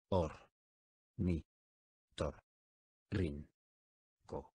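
"Or. Ni. Tor. Rin. Co." each syllable spoken apart with a pause. Shown as a demonstration. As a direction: split "Ornitorrinco" into separate syllables. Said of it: "dila silábicamente conmigo". (separando sílabas: "Or. Ni. Tor. Rin. Co.")

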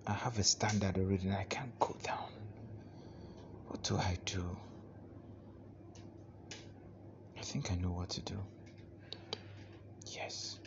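soft, low whispered worry to himself; worried inner monologue. Acted. Broke husband alone, whispering his worries to himself. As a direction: murmur your despair alone, half whispered.

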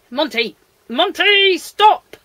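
high, loud cry to stop; exasperated shout, rising in pitch and volume; shouted command. Real, not acted. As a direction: call out sharply to a misbehaving pet. Calling a pet to stop.

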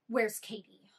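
a hint of an angry man at the door; demanding stranger. Retelling the boyfriend at the door, demanding question.

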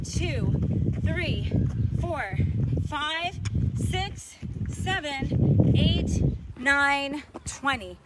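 shouted counts, punchy and loud; loud coach counting. Real, not acted. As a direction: bark out each rep count. Coach shouting counts during lunges.

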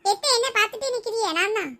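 put-on high, loud little kid; child's voice. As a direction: pipe up eagerly to your aunt in a kid's voice. Child figure greets aunt. (உயர்ந்த குழந்தைக் குரல்)

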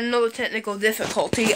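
usual speaking voice

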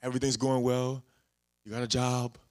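normal talking voice

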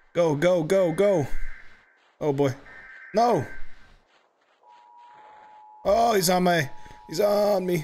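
frantic urgent yelling; panicked. Real, not acted. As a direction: flee in a panic. Chased again.

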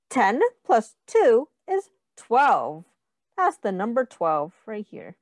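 usual speaking voice

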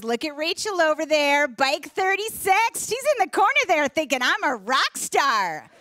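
imitating a chirpy spin instructor; mock instructor. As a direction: hype up the class like a spin coach. Instructor voiced in story.

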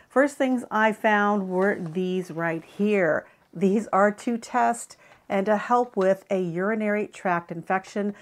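ordinary talk

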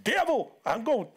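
hammed-up Southern outburst; mock Southern accent. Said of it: "they have a southern accent".

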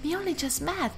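put-on high girly protest, softening after the start. Player voicing the date slowing Jason down, girly. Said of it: "that's my girly voice".

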